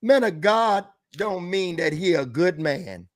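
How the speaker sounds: usual speaking voice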